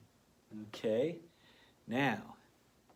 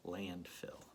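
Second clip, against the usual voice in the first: whispered word; 5 semitones lower, 7 dB quieter than usual. Shown as a demonstration. Teacher blending sounds.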